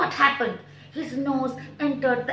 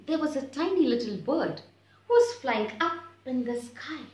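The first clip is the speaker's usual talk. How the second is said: ordinary delivery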